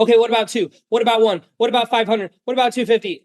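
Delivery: high, rushed desperate haggling. Shown as a demonstration. Salesman caving to a price objection, desperate. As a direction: sound like a panicked seller dropping the price. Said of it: "That's how you sound desperate".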